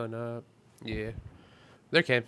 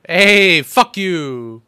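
angry shout; 7 semitones higher, 18 dB louder, much slower than usual. Real, not acted. Shouting at enemy in game.

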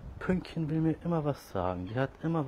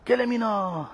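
very high, loud sing-song call; 11 semitones higher, 8 dB louder than usual. Real, not acted. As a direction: call the cat's name far across the park. Calling cat, very high. (sehr hoher, lauter Lockruf)

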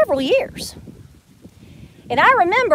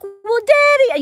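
put-on higher, teary little-girl plea; mock crying child; 9 semitones higher, 3 dB louder, slower than usual. Pleading with her daddy as a tearful child, higher. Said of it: "I started crying".

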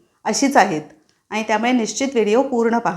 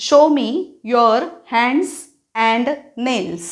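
slow teacher command; much slower than usual. Shown as a demonstration. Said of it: "teachers मुलांना काय सांगू शकतात".

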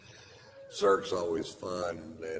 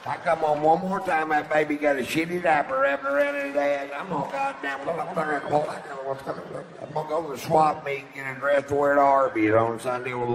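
mock trashy woman; 8 semitones higher, 8 dB louder than usual. Mocking the chatty woman behind him, loud hammed-up squawk. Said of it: "The kind of chicks".

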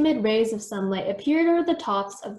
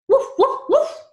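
high, loud yapping barks; dog barking; 6 dB louder than usual. A narrator's reading. Ghost dog Arf barking at the yard, loud yaps. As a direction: bark like an excited dog.

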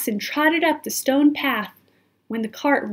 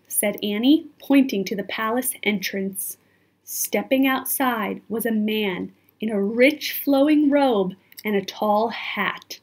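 plain reading voice

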